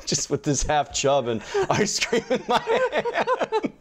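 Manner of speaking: high, slow, broken by laughter; cracking up mid-sentence; laughing while talking. Real, not acted. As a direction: barely get the story out for laughing. Laughs telling his embarrassing story.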